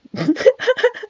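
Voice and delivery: high nervous giggle; nervous laughter. Real, not acted. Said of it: "nervous laughter aside".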